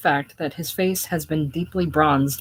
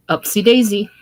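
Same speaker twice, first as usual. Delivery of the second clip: put-on loud playful call lifting a child; 4 semitones higher, 8 dB louder than usual. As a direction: sing it out as you swing her up.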